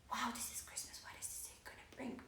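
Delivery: hushed whisper, barely voiced; breathy inner-voice whisper. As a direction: whisper the thought to yourself. Imagined thought, eyes closed.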